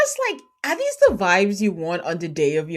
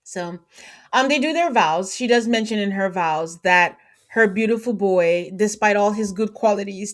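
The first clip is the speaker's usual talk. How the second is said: ordinary talk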